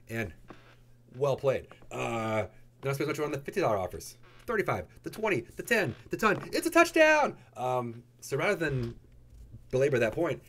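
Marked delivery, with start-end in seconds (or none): 4.5-7.4 mock sports commentator. Announcer calling a touchdown run, building to a shout. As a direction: call the run, then explode on the score. Mock football play-by-play call.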